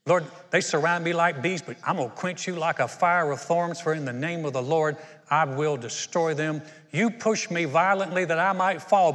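loud, ringing preacher voice; preaching at full force. Acted. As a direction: proclaim it like a fiery sermon.

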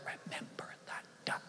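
silent breathy whisper; mouthed words. Retold or imitated. Sister silently threatening her brother, mouthed whisper. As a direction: mouth it, barely any breath. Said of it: "she mouths the words".

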